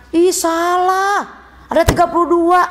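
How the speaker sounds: a pupil playfully telling a classmate they are wrong, mock scolding; mock contradiction. Playfully scolding classmate.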